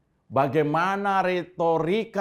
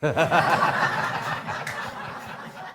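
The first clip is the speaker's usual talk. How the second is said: breathy villain's cackle; evil laugh. Pretend. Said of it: "Ketawa jahat". (tawa jahat berdesah)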